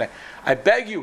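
beg, voice lifting on the appeal; pleading. Retold or imitated. Patient begs doctor for his life, retold pleading. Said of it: "He starts pleading for his life".

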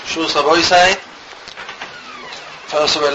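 ordinary delivery

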